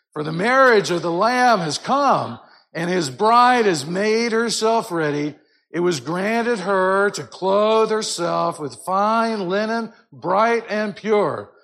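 loud, slow Alleluia; great multitude roaring praise like thunder; crying out. Shown as a demonstration. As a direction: roar it like many waters. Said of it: "crying out".

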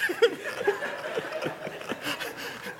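airy laugh up high; amused laugh at the mixed-up saying; high breathy laugh. Real, not acted. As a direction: let a high laugh escape.

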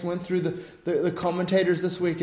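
ordinary delivery

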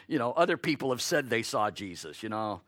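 a hint of doubting friends scoffing; sceptical onlookers. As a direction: brush them off as just emotional, mildly. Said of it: "they're probably saying to these two men".

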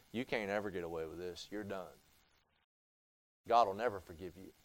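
low, hushed, quick menacing taunt; Satan's taunting. Pretend. The preacher voicing Satan taunting the sinner, low and hushed. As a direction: sneer it low and quiet like the devil. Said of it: "Satan says".

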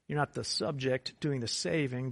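ordinary talk

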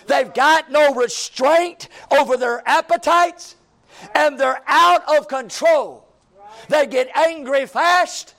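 rising, loud preacher's build; fiery preaching. Acted. Pastor warning the church about homes, fiery preaching. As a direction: preach it hard to the congregation.

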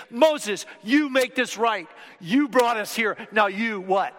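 loud, high accusing demand; mock angry crowd. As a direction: jab at Moses, demanding he fix it. Grumbling Israelites confront Moses.

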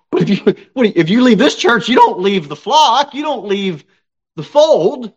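impassioned high preaching; preaching at full force. Real, not acted. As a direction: drive the point home with fire.

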